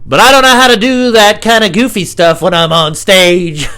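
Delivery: high, loud goofy wail. Acted. Comic imagining himself being silly on stage, goofy voice.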